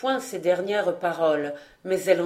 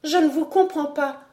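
high, alarmed denial; faltering dismay; 7 semitones higher than usual. A narrator's reading. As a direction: protest weakly in a raised pitch. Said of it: "balbutia Alix qui devint pâle".

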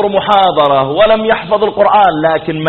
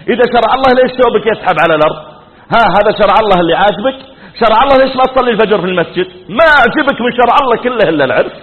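high, rapid-fire scolding; imitated angry wife; 4 semitones higher, 3 dB louder, faster than usual. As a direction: fire off accusing questions, pitch raised. Wife rebukes husband in quarrel. (توبيخ زوجة غاضبة، تقليدًا)

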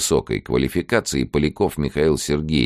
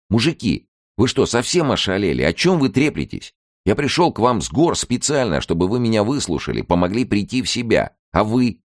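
higher, indignant outcry; indignant outburst; 10 semitones higher than usual. A narrator's reading. NKVD colonel reproaching his friends, indignant and higher-pitched. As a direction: colonel scolding the bickering men, higher and indignant. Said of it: "возмутился полковник Н К В Д".

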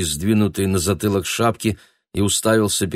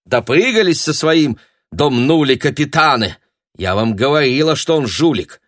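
loud, raised-pitch scolding; vexed reproach; 7 semitones higher, 5 dB louder than usual. Acted. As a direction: tell him off with heated I-told-you-so. Angry reproach continues the outburst.

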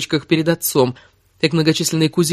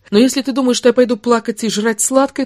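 higher-pitched, proud retort; defiant anger; 9 semitones higher than usual. Acted. Woman defying the lover who hurt her, heated. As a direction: refuse to be pitied, heated. She defies him in the quarrel.

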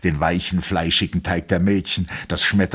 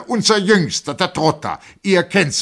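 much higher, loud, blustering; noisy announcement; 12 semitones higher, 5 dB louder than usual. A narrator's reading. Colonel introduces the youngest officer, loud and blustering. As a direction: boom out the introduction. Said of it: "Der Oberst lärmte".

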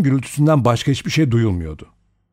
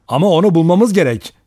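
urgent cry, higher and louder; 7 semitones higher, 6 dB louder, faster than usual. A narrator's reading. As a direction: call out in urgency. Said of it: "diye haykırdı". (haykırarak)

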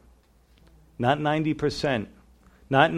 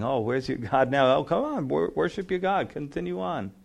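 soft, raised-pitch taunting; mocking; 4 dB quieter than usual. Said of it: "the enemies start mocking".

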